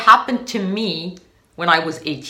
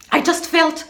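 normal talking voice

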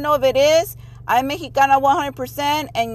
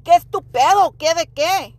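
acted tough challenge, pitched up; speaking up boldly; 3 semitones higher, 4 dB louder than usual. Mom showing how to speak up, bold challenge. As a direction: stand your ground and confront them. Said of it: "you speak up".

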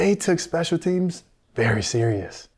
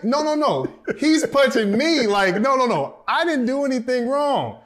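frantic protest; 10 semitones higher, 3 dB louder than usual. Retold or imitated. Rookie protesting to coach.

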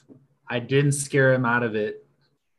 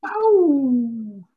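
high drawn-out howl; wolf howl; 11 semitones higher, 4 dB louder than usual. Pretend. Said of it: "the noise of a wolf howl".

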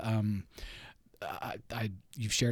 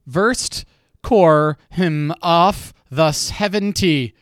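loud, high, slow sounding out; 4 semitones higher, 11 dB louder, much slower than usual. Real, not acted. Sounding out clue in a game.

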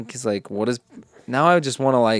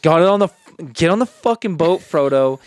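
mock Sam; 6 semitones higher, 6 dB louder than usual. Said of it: "It's like".

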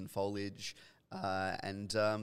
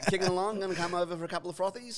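high, fast Aussie slang patter; punchy, raised-pitch Australian accent; broad Australian accent; 8 semitones higher, 5 dB louder, faster than usual. Shown as a demonstration. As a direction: rattle off a matey Australian invitation. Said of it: "can you do, uh, Australian accent".

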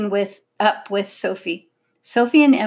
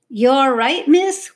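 higher and louder, out of breath; breathless policeman asking, pitch rising; wheezing; 6 semitones higher, 5 dB louder than usual. A narrator's reading. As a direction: gasp it out after running. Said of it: "wheezed the man in the uniform".

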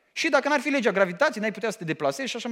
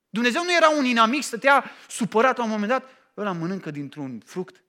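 ordinary delivery

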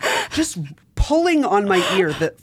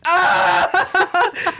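high, mock maniacal cackle; a hammed-up unhinged laugh burst; 13 semitones higher than usual. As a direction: cackle like the Joker. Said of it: "Maniacal laughing".

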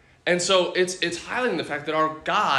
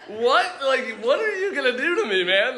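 high, incredulous question through laughter; trying not to laugh; 8 semitones higher than usual. Retold or imitated. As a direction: re-enact barely suppressed amusement, pitched up. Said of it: "trying not to laugh".

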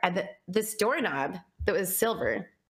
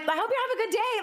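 much higher, fast and beaming; gushing kindness; faster than usual. Retold or imitated. Greeting the early-morning neighbour, much higher and gushing. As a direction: spill the goodwill out fast.

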